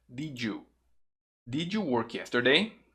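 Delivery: "Did you" run into "didju"; linked D-J sound. Shown as a demonstration. Teacher models for repetition, "Did you" as "didju". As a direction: link "Did you" with a J, then the whole question. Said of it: "Som de D J".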